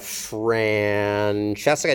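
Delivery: "Francesca" very slow, low and stretched out; syllable-by-syllable. Shown as a demonstration. Teacher transcribing the name Francesca, slow sounding-out. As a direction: draw out each syllable of "Francesca".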